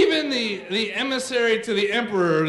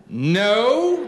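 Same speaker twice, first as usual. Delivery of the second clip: dismayed dad; 6 dB louder than usual. Acted. Dad's dismayed answer against kids' yes.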